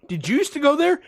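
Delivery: high-pitched mock question; imitating nosy stranger. Woman polling last person in line, high mock question. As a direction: chirp the question high, as her.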